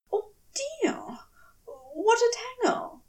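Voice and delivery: a flustered angel throwing up his hands; fluttery angel exclaiming. Acted. Angel fussing over the misunderstanding, fluttery.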